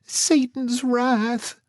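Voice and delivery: high, slow, dread-filled; fearful hush. Acted. Marcy naming the gang, slow and high with dread. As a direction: draw the name out in fear.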